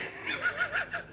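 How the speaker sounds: high squealing laugh. Real, not acted. Laughs at own impression.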